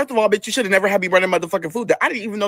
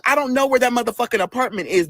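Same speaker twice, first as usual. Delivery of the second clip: ordinary delivery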